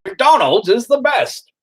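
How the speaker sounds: put-on drawn-out goofy parody accent; mock Americanized Japanese. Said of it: "They're not gonna just be Americanized".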